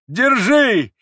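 very high, loud drawn-out holler; old man's frantic high scream; wild yell. A narrator's reading. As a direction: yell to have a fugitive seized. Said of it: "завопил он".

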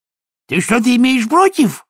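high, loud, indignant outburst; a loud, high, affronted snap; flaring up. Acted. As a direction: flare up at the sergeant. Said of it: "взвилась Бетти".

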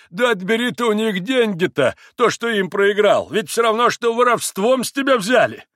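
high, insistent exclamation; high-pitched, vocal urging; proclaimed appeal. A narrator's reading. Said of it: "возгласил Трифон Борисович".

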